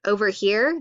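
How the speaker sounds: ordinary delivery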